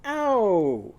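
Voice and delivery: high, loud Ow! like a bumped head; a high mock cry of pain; pretend ow. Shown as a demonstration. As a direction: shout Ow! as if you just hit your head. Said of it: "pretend like you bumped your head, and go".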